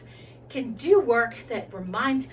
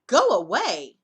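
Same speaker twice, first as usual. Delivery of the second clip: god yelling go away, much louder; shouted; 9 dB louder than usual. Acted. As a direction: bark it to send him off. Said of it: "and shouted".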